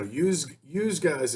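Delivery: slow Philly accent, yous guys drawn out. Shown as a demonstration. To cohost, showing how Philly says it, slow Philly accent. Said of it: "is like Philly".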